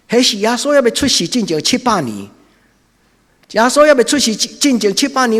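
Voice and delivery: loud, high, impassioned sermon; fiery preaching. Acted. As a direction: preach it out at full force. Preaching rises to full force.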